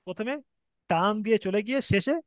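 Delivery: normal talking voice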